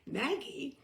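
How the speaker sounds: usual speaking voice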